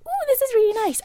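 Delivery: put-on high, delighted little girl; high childlike voice. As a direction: coo it like a nine-year-old. Child self quoted on boat.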